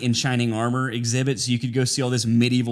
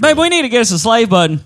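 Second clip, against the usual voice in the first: higher and louder, playful command to babe; mock bossy demand; 7 semitones higher, 7 dB louder than usual. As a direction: bark it like a spoiled lord calling a servant. Joking demand to partner.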